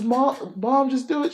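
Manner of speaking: high, loud, desperate urging; urgent plea. Retold or imitated. Daughter hands mom the shotgun, urging her. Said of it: "She says".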